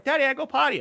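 put-on high, loud little-girl voice; toddler voice. Dad imitates his toddler daughter asking for the potty, high and loud. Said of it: "So she's like".

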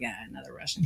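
low, soft, unimpressed aside; mock indifference. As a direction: imitate someone mildly let down. Locals unimpressed by yet another Russian.

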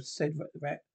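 usual speaking voice

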